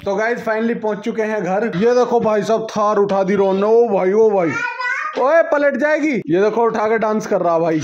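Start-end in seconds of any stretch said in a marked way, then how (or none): 3.7-6.1 startled, rising yelps; alarmed shout. Real, not acted.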